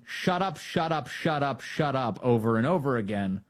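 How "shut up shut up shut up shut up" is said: mock shout of shut up, pitched up; hammed-up shut up; mimicking a yell. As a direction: imitate a child yelling shut up. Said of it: "Al Green just starts yelling".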